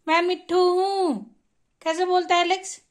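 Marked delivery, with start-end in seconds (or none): 0.1-1.4 "मैं मिठू हूँ" spoken slowly, lean on "Mithu"; slow and clear, leaning on "Mithu", for the bird to copy; slow demo line. Shown as a demonstration. Owner modelling a phrase for the parrot, slow, stressing "Mithu". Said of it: "बोलो तो".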